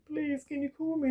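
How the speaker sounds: high, pleading little voice; pleading request. Retold or imitated. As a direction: beg the sister to call for him. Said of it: "saying to my sister".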